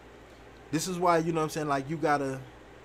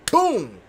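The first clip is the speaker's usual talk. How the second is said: loud imitated boom of a kicked door; 7 semitones higher, 9 dB louder than usual. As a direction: slam the sound out, loud and high. Dad kicking the door open.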